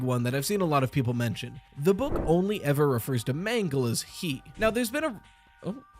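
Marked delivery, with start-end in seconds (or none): none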